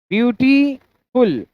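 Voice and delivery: "ब्यूटीफुल" slowly split as byuti, ful; syllable by syllable. Shown as a demonstration. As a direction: stretch "ब्यूटीफुल", pausing before the last syllable. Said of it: "दो syllable हो गया". ("ब्यूटीफुल" को धीरे से दो हिस्सों में बोलें)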